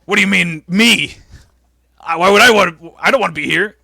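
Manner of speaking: confused and pissed. Retold or imitated. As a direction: sputter in protest, imitating an offended boss. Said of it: "Vince looks confused and pissed".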